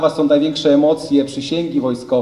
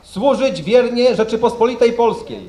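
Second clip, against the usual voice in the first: formal oath reading; 4 semitones higher than usual. Real, not acted. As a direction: dictate the oath solemnly for troops. Officer reads oath for repeating. (uroczyste odczytywanie przysięgi)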